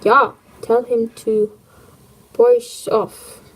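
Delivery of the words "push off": put-on German accent. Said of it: "German accent".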